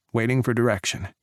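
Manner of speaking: ordinary delivery, nothing marked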